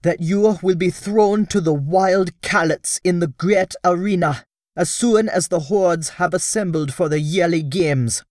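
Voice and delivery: agitated warning. Acted. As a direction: warn them in alarm. Sola delivers grim news.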